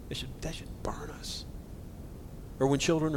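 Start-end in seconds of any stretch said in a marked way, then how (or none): 0.1-1.4 whisper. Real, not acted. Sermon on abused children.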